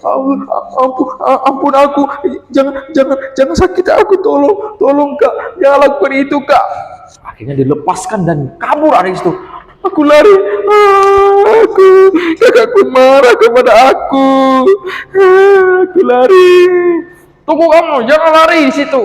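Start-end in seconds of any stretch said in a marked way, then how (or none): 0.0-6.6 high, trembling plea for mercy; terrified begging. Acted. Frightened little brother begging his angry sister, high and desperate. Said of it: "adiknya ketakutan". 9.8-16.5 put-on crying child running away. 17.5-19.0 high, loud and fast; angry call. Acted. Sister shouting after her fleeing brother, loud.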